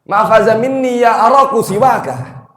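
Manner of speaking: high, loud, slow challenge in Arabic; jealous outburst. Acted. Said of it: "Saking cemburunya".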